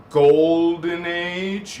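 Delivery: slow, stretched golden age; drawn out. Shown as a demonstration. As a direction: stretch golden out long and loud. Drawn-out rise of the wave.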